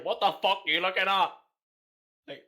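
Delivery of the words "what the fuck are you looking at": put-on loud drunken Belfast snarl; angry drunk Belfast man. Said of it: "they sound like an angry drunk man from West Belfast".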